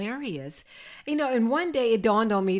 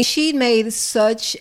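ordinary talk

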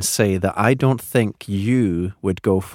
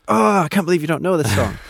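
mock listener; 9 semitones higher than usual. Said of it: "someone out there is thinking".

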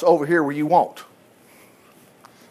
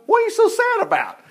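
high-pitched king's question. Acted. King questions sad cupbearer.

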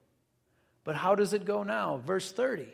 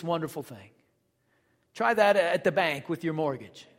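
ordinary talk, nothing marked

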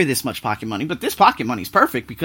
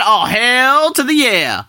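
slow, higher, drawn-out hell yeah; gleeful yes; 8 semitones higher, 4 dB louder, slower than usual. Retold or imitated. Vlogger celebrating a cheap ticket, gleeful and slow. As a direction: savour the yes with delight.

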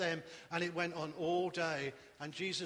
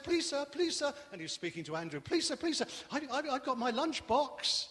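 put-on high, pleading child voice; eager little boy; 8 semitones higher than usual. Little boy offering his lunchbox to Andrew, high eager pleading. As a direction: beg politely like a small boy.

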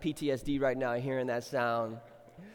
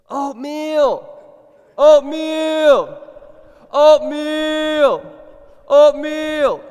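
put-on high loud child's cry of oatmeal; little daughter shouting from her bed for oatmeal; toddler yelling; 11 semitones higher, 17 dB louder, much slower than usual. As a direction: yell it like a two year old at dawn. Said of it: "She would yell".

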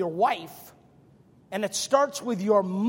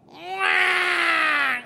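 put-on child's wail; 8 semitones higher than usual. Preacher mimics a little child who dislikes him, wailing. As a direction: burst out in a drawn-out cry. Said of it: "they usually go".

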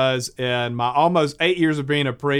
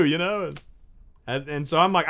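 ordinary delivery

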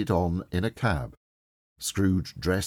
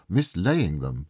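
usual speaking voice

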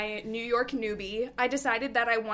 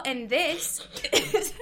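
laughing; 5 semitones higher, much slower than usual. Real, not acted. Failed podcast intro, laughing.

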